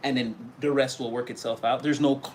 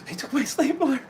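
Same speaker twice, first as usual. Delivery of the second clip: high, breathless delight over the stapler; thrilled gasp; 11 semitones higher than usual. Retold or imitated. Coworker swooning after you leave, squealing high. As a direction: squeal it like a giddy secret.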